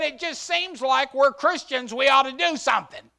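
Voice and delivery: high, loud insistent wife; wife's earnest plea. Retold or imitated. Wife urging the pastor to help, high and loud. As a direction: urge him on, high and loud. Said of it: "She said".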